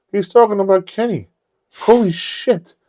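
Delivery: high-pitched astonished realisation; amazed coworkers. Retold or imitated. Coworkers recognising their friend's story on TV, amazed. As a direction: imitate stunned, excited coworkers.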